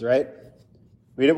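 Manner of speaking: ordinary talk, nothing marked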